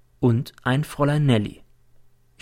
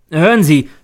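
louder, higher demand; shouting; 5 semitones higher, 8 dB louder than usual. Acted. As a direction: shout at him to listen. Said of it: "schrei ich ihn an". (laut anfahrend)

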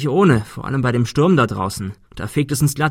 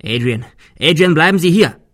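loud, urgent call; calling after someone; 6 semitones higher, 7 dB louder than usual. Acted. Arthur calls after fleeing Adrian, shouting. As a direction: shout to stop him leaving.

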